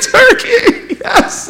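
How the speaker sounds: squealed high with a laugh; excited cry. Retold or imitated. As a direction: shout it like you finally got one. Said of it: "I was like".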